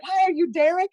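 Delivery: put-on chirpy high girl voice; high mock-girly hello; mock woman greeting. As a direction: squeak it up high and bright. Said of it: "She's like".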